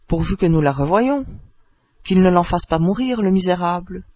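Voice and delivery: plain reading voice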